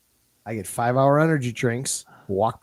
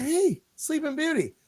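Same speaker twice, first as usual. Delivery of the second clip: high, sing-song wake-up tease; teasing girlfriend; 12 semitones higher, 5 dB quieter than usual. Retold or imitated. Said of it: "she's like".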